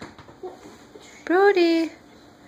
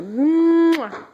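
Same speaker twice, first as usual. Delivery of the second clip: kiss sound; 3 semitones higher than usual. Real, not acted. Blowing a kiss to baby.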